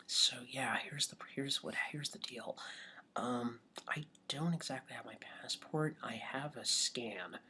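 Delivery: hushed, halting, half-whispered plea; sheepish confession. Retold or imitated. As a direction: confess very quietly, stumbling as you go. Acting out sheepish plea to driver.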